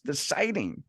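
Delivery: ordinary delivery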